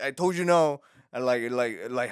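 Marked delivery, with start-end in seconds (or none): 0.0-0.8 shouted high and loud at mom; angry shout. Retold or imitated. Son yelling at his mom about breakfast, loud and angry. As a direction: snap it out, fed up and yelling. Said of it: "I was like".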